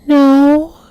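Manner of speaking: put-on high kid fibbing to firemen; little kid voice. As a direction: play dumb like a small child. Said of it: "And I said".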